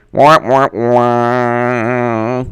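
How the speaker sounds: mock failure horn "wah, wah, wah"; comic womp-womp noise; sad trombone. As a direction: sound a trombone's letdown, last note held. Imitates sad trombone after question.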